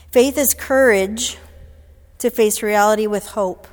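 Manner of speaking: forceful declaration. Acted. Speaker to audience, a loud emphatic proclamation. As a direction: proclaim it boldly to the room. Emphatic quote, then repeated quietly.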